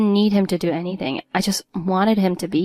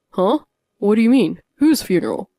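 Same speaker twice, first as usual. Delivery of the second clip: louder, startled questions; shocked husband; 6 dB louder than usual. A narrator's reading. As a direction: caught completely off guard.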